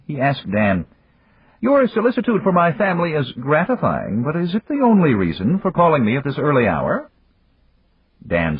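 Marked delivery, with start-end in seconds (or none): 1.6-7.1 higher, unhurried voice of an amused magnate; Yamagata. A narrator's reading. Yamagata chiding Dan for the early call, higher and amused. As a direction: tease an old friend from a position of power.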